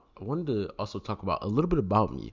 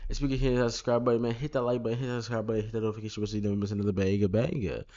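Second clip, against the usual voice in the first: very fast, higher-pitched sales patter; rapid-fire subscribe plug; 4 semitones higher, much faster than usual. Pretend. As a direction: rattle it off in one breathless run.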